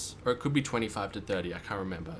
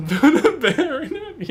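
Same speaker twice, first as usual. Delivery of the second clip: laughing; 9 dB louder, slower than usual. Real, not acted. Laughing joke after the drop.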